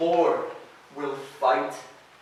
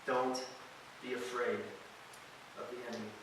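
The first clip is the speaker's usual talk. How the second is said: hushed verse; 4 semitones lower, 15 dB quieter, much faster than usual. Shown as a demonstration. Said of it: "he says this".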